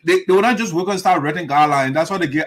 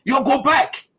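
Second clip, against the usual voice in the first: higher, rude brush-off; rude dismissal; 6 semitones higher, slower than usual. Retold or imitated. Imitating the senator talking down to the elders, rude. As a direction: talk down to elders like a rude child. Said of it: "talking to his parents like that".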